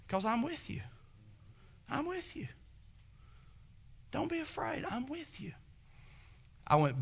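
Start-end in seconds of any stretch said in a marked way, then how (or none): 0.1-5.5 softer and higher, gently reassuring; softly whispered divine comfort; tender God voice. Acted. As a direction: drop to a hush and comfort him. God's voice comforting Joshua.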